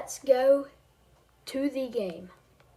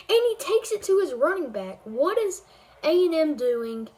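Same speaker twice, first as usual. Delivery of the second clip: excited commentator call; excited upset call; 2 semitones higher, 5 dB louder than usual. Acted. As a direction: sell the upset like a broadcaster. Calling the game-ending play.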